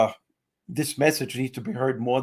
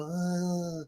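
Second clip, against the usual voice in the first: softer, higher mock Brando mumble; a hint of a Sicilian godfather; father's Godfather voice; 3 semitones higher, 5 dB quieter than usual. Son imitating his Sicilian father, lightly Brando-like. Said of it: "My father would go".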